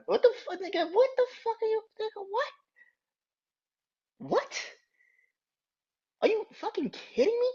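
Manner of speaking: high-pitched outraged disbelief. Real, not acted. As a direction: squeal in disbelief.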